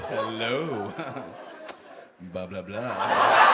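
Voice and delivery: low slow silly blah chant; low drawl. Acted. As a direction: drag out each blah deep. Bob Loblaw in low voice.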